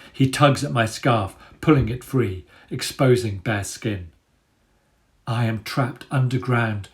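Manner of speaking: steady, vivid storytelling voice; story narration. A narrator's reading. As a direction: read the chapter to the listeners. Reads chapter narration.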